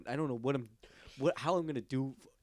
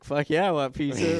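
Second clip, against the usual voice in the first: excited; 4 semitones higher, 9 dB louder than usual. Retold or imitated. Acted reply to prank caller.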